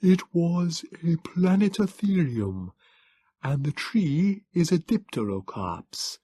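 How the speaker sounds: high, slow, strange Morlock voice; a small, cool, learned creature speaking slowly; calm alien scholar, high and slow. Acted. Morlock figure voiced throughout.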